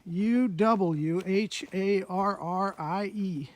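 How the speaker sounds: U W H A R R I E spelled letter by letter, slowly; spelling out slowly. Shown as a demonstration. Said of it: "Uwharrie is spelled".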